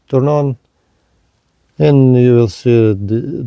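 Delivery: ordinary delivery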